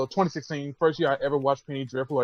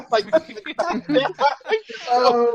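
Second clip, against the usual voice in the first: laughing; 7 semitones higher than usual. Real, not acted. Laughing through rant end.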